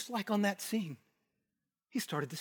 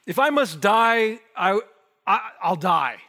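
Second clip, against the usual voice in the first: loud, slow and fierce, higher pitched; zealot's vow; 5 semitones higher, 9 dB louder, slower than usual. Retold or imitated. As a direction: declare it like a fanatic ready to die. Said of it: "the language of the zealots".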